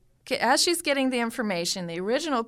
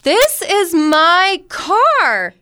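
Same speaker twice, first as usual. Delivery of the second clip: high, loud and slow, stretching car; indignant insistence; 9 semitones higher, 11 dB louder, much slower than usual. Retold or imitated. Mother telling police officers it is her car, high and stretched. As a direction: insist it's your own car, drawn out. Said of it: "And she goes".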